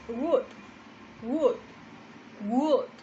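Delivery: "would" said like "wud", no L; slow "would" with a silent L; would without L. Shown as a demonstration. Teacher drilling "would" so students drop the L. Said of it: "la pronunciación no incluye la L".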